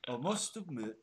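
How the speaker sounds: put-on brief failed go at Andy's accent; botched imitation of Andy's accent. Co-host also mimics absent Andy, badly done accent. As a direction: try a friend's accent, unconvincingly. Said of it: "I can't do it either".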